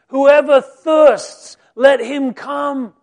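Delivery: very loud, slow, drawn-out call; proclaimed invitation. Acted. Jesus inviting the crowd, very loud and slow. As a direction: ring it out slowly like a call from heaven.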